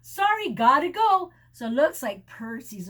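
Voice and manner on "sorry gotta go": loud high quick goodbye; hurried exit. A narrator's reading. Goose escaping the corgis, loud. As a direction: blurt it and flee.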